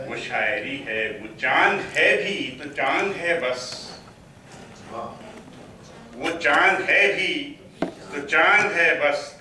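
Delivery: declaimed Urdu verse, deliberate pauses between phrases; poetry recital. Acted. Poet reciting to an appreciative mushaira audience, poetry recital. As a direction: swell on repeated lines, ease down on the refrain.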